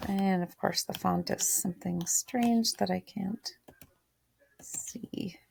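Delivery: whispered aside to herself; whispered muttering. Real, not acted. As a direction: breathe it under her breath. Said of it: "muttering to herself".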